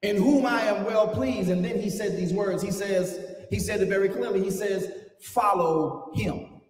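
preaching at full force. Acted. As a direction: preach it out, building the fire.

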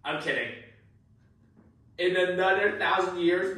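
ordinary talk, nothing marked

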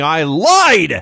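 punched-up loud wrestler confession; mock defiant outburst. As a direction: blurt it out hammily with a big swooping LIED.

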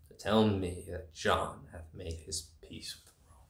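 hushed, breathy contempt; scornful whisper. Acted. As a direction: whisper it with disdain. Scornful repeat of message.

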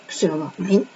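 soft unvoiced "Kusura bakmayın", breathed out; whispered apology. Real, not acted. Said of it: "Sesim kötü gelebilir". (fısıltıyla özür diler gibi)

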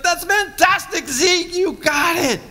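an angel cheering him on; hammed-up upbeat angel; cheery Gabriel.

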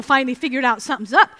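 ordinary talk

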